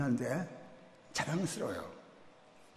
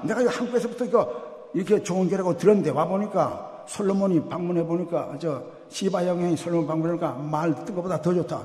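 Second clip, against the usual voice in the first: normal talking voice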